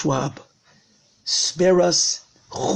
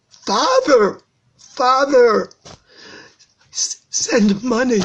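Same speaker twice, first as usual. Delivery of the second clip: desperate, high cry for help; drawn-out, wailing plea of a son; anguished crying; 7 semitones higher, 4 dB louder, slower than usual. Acted. Rav shows how son begged his father, crying anguish. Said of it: "you hear the child crying".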